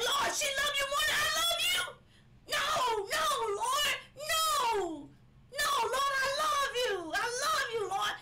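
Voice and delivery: high, loud wail to God; tearful pleading. Retold or imitated. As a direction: cry out the retold prayer, loud and pleading. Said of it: "I wanted to cry".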